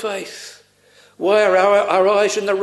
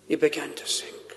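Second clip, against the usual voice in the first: hushed repeat; 5 semitones lower, 10 dB quieter than usual. A narrator's reading. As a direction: voice sinking almost to a whisper. Repeats the sinking, dropping away.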